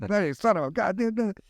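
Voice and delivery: high, exasperated mock swearing; frustrated swearing. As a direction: blurt it out like you just blew the shot. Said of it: "I'm inventing swear words".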